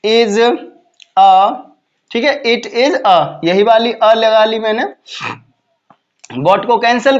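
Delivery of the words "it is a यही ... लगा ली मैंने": ordinary talk, nothing marked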